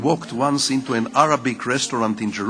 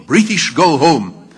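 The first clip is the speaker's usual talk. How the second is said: slow, louder mock chant of a street slogan; retold shout; 7 dB louder, slower than usual. Kids in Jerusalem shouting at British patrols, slow loud chant. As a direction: call it out like a kid at a patrol. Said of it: "what we kids in Jerusalem used to shout".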